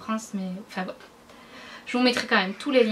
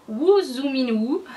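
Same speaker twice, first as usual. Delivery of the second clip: slow, louder French-accented English, breaking into a laugh; clumsy English accent; 3 semitones higher, 5 dB louder, much slower than usual. Real, not acted. Vlogger reading a shade name to viewers, clumsy French-accented English. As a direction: stumble slowly through English with a French accent, amused. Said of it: "mon accent anglais qui est déplorable".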